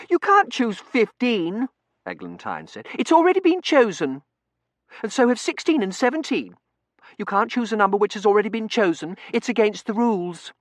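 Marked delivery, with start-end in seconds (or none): 0.1-1.7 put-on high, loud, slow child voice; piercing childish insistence; objecting little girl. 3.0-10.5 put-on very high, loud child voice; piercing, indignant schoolgirl protest; rule-quoting little girl. Eglantine voiced in story.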